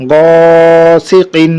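"গসিকিন" with long held "গ", short endings; stretched reading. Shown as a demonstration. Teacher joining syllables for students, "গ" drawn out. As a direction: sustain the first vowel of "গসিকিন". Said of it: "টানা পড়ে চলে যাবো".

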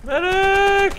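man down shout. Real, not acted. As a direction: scream it, voice cracking high. Calling for medic again.

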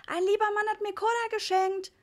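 put-on high, loud gleeful little boy; excited high-pitched call; calling child. A narrator's reading. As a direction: announce it proudly like a delighted kid. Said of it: "rief Ritchie".

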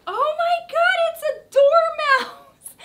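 very high, loud squeal of delight; excited squeal. Real, not acted. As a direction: shriek with pure delight. Reveals figure, squeals.

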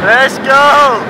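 loud, high rallying cry; shouting. Real, not acted. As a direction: fire everyone up. Starting the descent.

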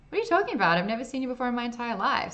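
high, loud incredulous protest; indignant denial. A narrator's reading. Accused man denies knowing her.

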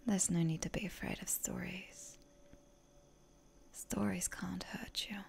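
ordinary talk

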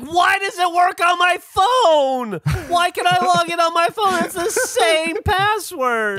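high, slow furious yelling; angry shout. Retold or imitated. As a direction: reenact the rage at the phone, loud and high. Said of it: "And I'm like".

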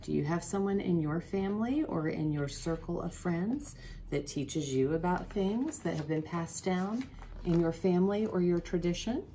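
ordinary delivery, nothing marked

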